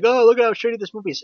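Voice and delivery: high, loud, rushed gushing; mocking quote. Reviewer imitating ironic viewers of bad movies, mocking.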